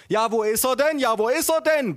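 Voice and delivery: mock-mother cooing loudly at a baby; bleating mother. A narrator's reading. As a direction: bleat it across the table in a high voice. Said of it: "blegte meine Mutter quer".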